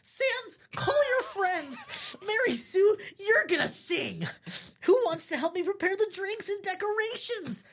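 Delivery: very high, squealing enthusiasm; excited babbling. Acted. Blueberry organises the party, babbling excitedly. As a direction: give orders in a giddy high voice. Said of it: "Blueberry babbles on".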